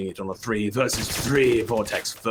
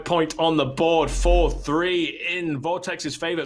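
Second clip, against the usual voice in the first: excited play-by-play; 5 semitones higher, slower than usual. Real, not acted. Caster announcing a scan that just made it, excited. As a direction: belt out the score update, drawing it out. Live call of scan going through.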